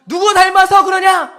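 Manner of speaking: loud, high mock outburst; comic paternal scolding, loud and high; proud scolding father. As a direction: bellow like an exasperated dad. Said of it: "자존감이 높은 아저씨들 이렇게 하죠".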